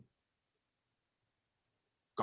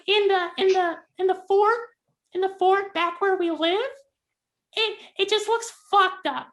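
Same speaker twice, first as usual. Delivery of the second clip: put-on squeaky high kid pirate; very high childlike voice, yelled; high child voice; 4 dB louder than usual. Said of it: "Quincy speaks up and he's like".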